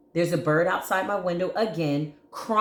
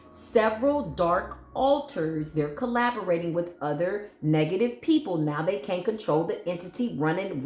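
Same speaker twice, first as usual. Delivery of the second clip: ordinary delivery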